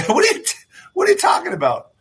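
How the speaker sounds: very high-pitched feigned bafflement; hammed-up high protest; mock denial. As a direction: play innocent, voice way up high. Acting police denying the robbery.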